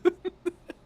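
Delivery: squeaky, very high laugh; real laugh bursting out high; high burst of laughter. Real, not acted. Reacting to absurd tweet.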